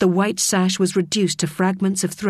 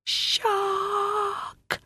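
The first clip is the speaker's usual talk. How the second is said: high, soft breathless cry of shark; a voiceless, terrified mouthed shout; 6 dB quieter than usual. A narrator's reading. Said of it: "He mouthed the word".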